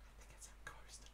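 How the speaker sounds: very soft breath-only aside; secretive whisper. Acted. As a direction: whisper it so the stranger can't hear. Aside hidden from the stranger.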